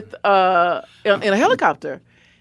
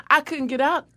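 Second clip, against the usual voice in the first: usual speaking voice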